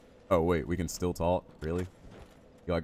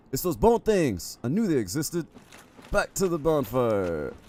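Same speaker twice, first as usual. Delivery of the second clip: gasp, then high, loud playful panic; alarmed outcry; 8 semitones higher, 5 dB louder, slower than usual. Real, not acted. Player fleeing bone creatures to the bonfire, alarmed cry. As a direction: cry out high and slow as you retreat.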